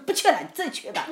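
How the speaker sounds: retold sulk of a spouse backing out; sulky refusal. Wife refusing to join husband's meeting, acted sulk. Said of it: "我在家生闷气哈".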